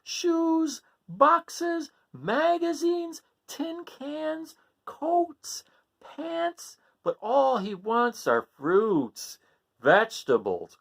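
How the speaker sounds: high exasperated mother voice; Mother Goat complaining. Acted. Mother complaining to the doctor, high and exasperated. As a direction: list it off, high and fed up. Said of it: "said Mother Goat".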